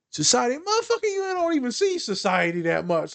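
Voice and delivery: high, exasperated rant. Acted. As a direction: vent about nosy society. Mock rant about society.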